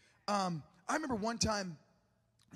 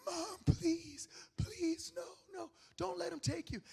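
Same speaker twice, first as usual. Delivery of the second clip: put-on high, strained child's plea; retold desperate pleading; 9 semitones higher than usual. Five-year-old clinging to his handcuffed mom, mock screaming plea. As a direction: beg like a frightened kid, voice up high. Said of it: "and I'm screaming".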